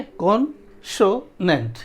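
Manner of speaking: कॉन्सोनेंट in slow parts: कॉन, सो, नेंट; syllable breakdown. Shown as a demonstration. Teacher counting three syllables for students, slowly separated. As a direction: space out each syllable of कॉन्सोनेंट. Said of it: "तीन syllable का है".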